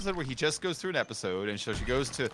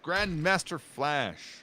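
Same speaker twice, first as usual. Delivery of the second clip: slow, higher, stubborn name; slow insistence; 4 semitones higher, much slower than usual. Real, not acted. Streamer insisting on the name against his friend, slow. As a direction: drag it out stubbornly.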